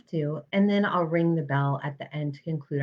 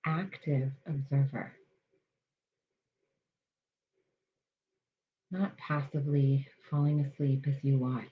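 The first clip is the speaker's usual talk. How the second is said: guided meditation. Acted. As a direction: ease listeners into calm, unhurried.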